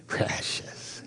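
Precious whispered in a Gollum hiss. Retold or imitated. Pastor imitating the cursed hobbit, whispered hiss. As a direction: hiss it like Gollum clutching the ring. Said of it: "Who, who said that?".

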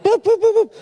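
very high little boops; imitated bird chirps. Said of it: "it went like".

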